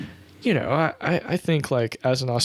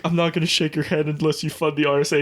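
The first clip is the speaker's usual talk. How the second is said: quick, higher mock defiant woman; 3 semitones higher, faster than usual. Woman refusing the PM's handshake, quick mock ultimatum. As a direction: fire it off like an ultimatum. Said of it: "the woman was just like".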